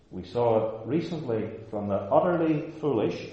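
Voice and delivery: ordinary delivery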